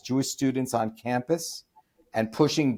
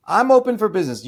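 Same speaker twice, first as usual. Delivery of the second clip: louder, faster, pitched up on "open"; emphatic repeat; 8 semitones higher, 5 dB louder, faster than usual. Shown as a demonstration. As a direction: punch "open" high and loud, quick. Repeats slogan, second time louder.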